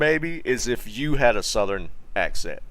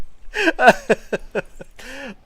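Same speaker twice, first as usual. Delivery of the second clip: real laugh; 12 semitones higher, 5 dB louder than usual. Real, not acted. Reacting to funny chat message.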